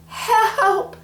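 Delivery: high, loud cry for help; shout. Acted. Mary shouting for help while crushed, high and loud. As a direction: scream out for help. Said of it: "shouted Mary".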